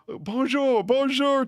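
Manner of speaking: high, loud, cheery Bonjour in a bad American accent; mock American tourist doing French; horrible American accent. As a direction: sing out a hammed-up American-accented French greeting. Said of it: "with his horrible American accent".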